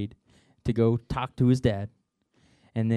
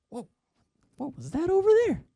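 much higher and fast, alarmed; startled; faster than usual. Acted. Disciple on the boat spotting something, startled and high. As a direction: call out startled at something in the dark.

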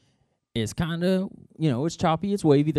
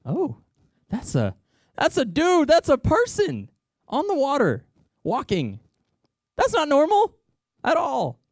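much higher and louder, freaked out; alarmed; 14 semitones higher, 4 dB louder than usual. Acted. Disciples spotting a person walking on water, high and alarmed. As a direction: shriek in disbelief at a man on the water.

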